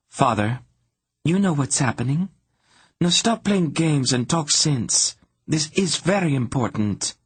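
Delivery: high-pitched, exasperated demand; urgent insistence. A narrator's reading. Princess telling her father to be serious, urgent.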